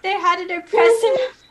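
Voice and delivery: high, breaking up with real laughter; helplessly laughing mid-sentence. Real, not acted. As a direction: try to get the words out through giggles.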